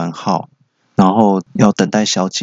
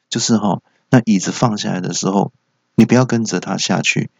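usual speaking voice

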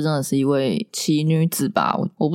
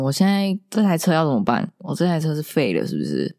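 usual speaking voice

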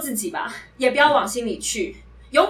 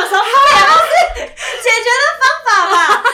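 laughing reply; 10 semitones higher, 9 dB louder than usual. Retold or imitated. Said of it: "我想说".

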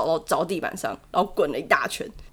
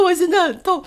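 laughing mock whimper; 9 semitones higher than usual. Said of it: "然后我就说".

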